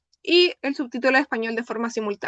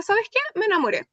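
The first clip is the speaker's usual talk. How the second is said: excited confession; 8 semitones higher than usual. Retold or imitated. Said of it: "la chica de blanco que le dice a su amiga".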